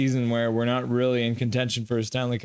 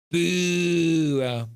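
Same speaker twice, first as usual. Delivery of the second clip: long drawn-out boo, raised in pitch; French boo; 4 semitones higher than usual. Shown as a demonstration. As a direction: boo like a disapproving crowd. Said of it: "How do I boo in French?".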